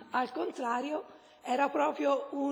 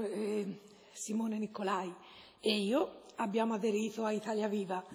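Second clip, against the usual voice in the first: ordinary talk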